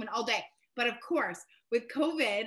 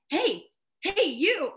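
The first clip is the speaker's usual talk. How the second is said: loud, higher-pitched hail; imitated calling out; 7 semitones higher, 6 dB louder, much slower than usual. Older woman to a shopper by the peppers, calling out. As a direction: mimic someone trying to get a stranger's attention.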